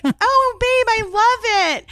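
high, slow, breathy-sweet gush; gushing high coo. Retold or imitated. Wife gushing over the funeral flowers, high and slow. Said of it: "But she was like".

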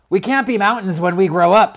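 exasperated seed sibling; exasperated objection. A narrator's reading. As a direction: put the dreamer straight, forcefully. Merwin objects to Louise.

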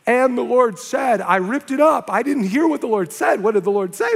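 frantic in a bad dream; nightmare panic. The man dreams about the words, mock anxious. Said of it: "He had a nightmare about it".

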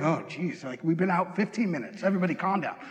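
low, soft exasperated muttering; weary parent. Acted. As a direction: settle the kids with tired patience. Parent answering whining kids.

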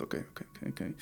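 whispered nervous self-talk; breathless whisper of oké; anxious whisper. Retold or imitated. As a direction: whisper to yourself, trying not to disturb. Anxious inner voice, tiptoeing.

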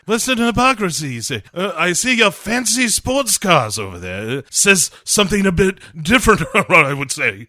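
hammy British spy voice; mock British secret-agent retort; James Bond. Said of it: "Bond is like".

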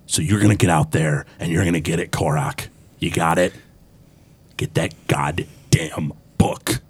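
low, menacing villain giving orders; gruff boss voice. Acted. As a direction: order the henchman in a low, gruff voice, slowing at the end. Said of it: "gruff voice".

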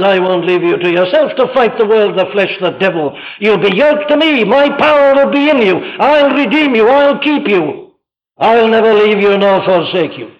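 high, impassioned preacher's declaration; fervent preaching. Acted. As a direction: pour out the promise with mounting force. Preaches Christ's promise at full force.

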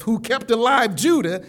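normal talking voice

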